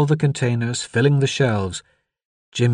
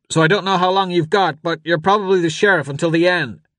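high, raised, pressing voice; urgent father; 8 semitones higher, 3 dB louder than usual. A narrator's reading. Father hands charge to the deputy by radio, urgent. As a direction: push the words out over the din.

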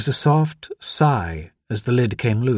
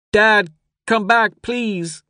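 high, slow plea dropping on the last word; pleading boy; 11 semitones higher, 4 dB louder, much slower than usual. A narrator's reading. Boy grips the device calling Dad back, pleading. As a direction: beg your father to answer, high-voiced.

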